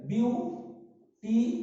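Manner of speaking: "Beautiful" very slowly as বিউ, টি, ফুল; long pauses inside "beautiful": বিউ... টি... ফুল; three separated syllables. Shown as a demonstration. Teacher showing a three-syllable word, "beautiful" slowly split in three. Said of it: "ত্রিমাত্রিক হয়ে গেল".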